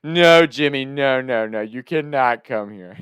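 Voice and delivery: loud, slowing emphatic refusal; freaking-out grandma. Retold or imitated. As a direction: imitate grandma panicking and forbidding. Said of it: "freaking out on the phone".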